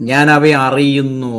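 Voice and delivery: drawn-out, weighty scripture quote; slow solemn quote. Acted. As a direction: stretch out അറിയുന്നു low and steady. Quoting Jesus slowly for emphasis.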